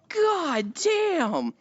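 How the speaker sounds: drawn-out, high-pitched astonishment; mock amazement. Acted amazed reaction to kill.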